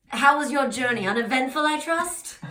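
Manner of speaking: high, loud French clerk asking politely; French accent. Acted. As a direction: inquire as a French official. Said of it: "oh, he's French".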